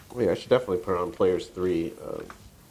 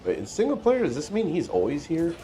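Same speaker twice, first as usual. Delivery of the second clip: surprised; 8 semitones higher than usual. Real, not acted. Sudden discovery in game.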